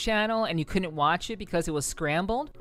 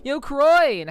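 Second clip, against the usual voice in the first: cheerful shout-out; loud greeting; 10 semitones higher, 10 dB louder than usual. Real, not acted. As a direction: call out a hello. Greeting chatter by name.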